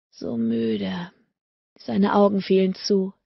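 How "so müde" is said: much lower, drowsy sigh of a voice; sleepy murmur, much lower and softer. A narrator's reading. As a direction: let it sink low and drowsy. Exhausted lover drifting to sleep.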